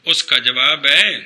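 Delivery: usual speaking voice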